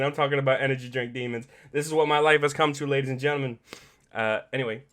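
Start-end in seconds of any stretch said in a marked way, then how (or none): none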